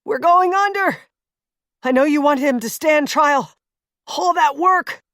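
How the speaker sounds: a hint of panic as he sinks; Jace in the water. Jace voiced, drowning.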